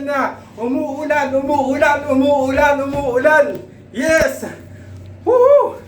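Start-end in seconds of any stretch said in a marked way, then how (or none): 5.3-5.8 high, loud whoop of joy; excited whoop. Real, not acted. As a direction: cheer out loud at the first rain. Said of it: "Ay, na-excite ako".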